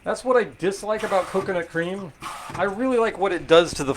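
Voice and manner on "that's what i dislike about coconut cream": ordinary delivery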